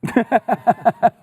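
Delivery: genuine laughing fit; burst of laughter. Real, not acted. Host laughs at the punchline.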